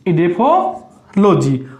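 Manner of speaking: drawn-out answer word; slow dictation. Shown as a demonstration. Teacher to students, drawing the answer out slowly. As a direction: say it slowly so students can write it.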